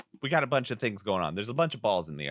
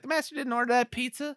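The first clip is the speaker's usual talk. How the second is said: high-pitched mock contradiction; cartoonish, high denial; retorting guards; 14 semitones higher than usual. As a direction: squawk it back in disbelief.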